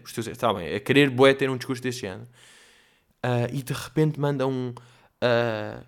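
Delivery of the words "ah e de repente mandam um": ordinary delivery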